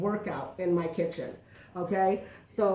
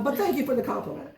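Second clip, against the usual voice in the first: ordinary delivery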